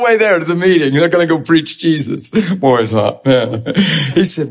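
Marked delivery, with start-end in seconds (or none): 2.3-3.6 mock complaining. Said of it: "complaining the whole time".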